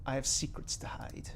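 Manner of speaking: ordinary talk, nothing marked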